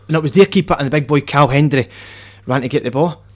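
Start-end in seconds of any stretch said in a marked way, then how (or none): none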